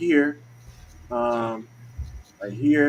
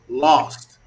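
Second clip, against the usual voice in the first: "Lost" set apart and said louder; emphasis on Lost; 6 dB louder than usual. Real, not acted. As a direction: land hard on the single word "Lost". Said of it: "Let me put that emphasis on lost".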